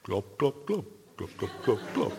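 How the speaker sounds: soft bubbling glub glub of a fish; fish noises. Pretend. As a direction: mouth it like a goldfish, quiet and silly. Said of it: "Or in a different language".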